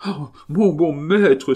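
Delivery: high, slow pleading cry; desperate cry. A narrator's reading. Servant throwing himself at his master's feet, pleading high. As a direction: beg on your knees, voice up high. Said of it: "s'écria Longue Avoine".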